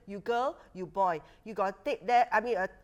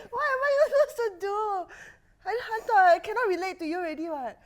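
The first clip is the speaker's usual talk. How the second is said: laughing; 8 semitones higher than usual. Real, not acted. Cracking up at squirrel joke.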